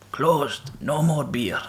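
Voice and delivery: put-on barmaid's German accent, lightly done; a hint of a German barmaid closing up. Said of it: "with a German accent".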